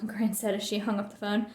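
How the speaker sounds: ordinary delivery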